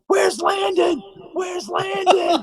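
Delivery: high, loud, frantic shouted question; retold yelling. Glenn bursting into rehearsal shouting for Landon, high yell. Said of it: "he finally stops yelling".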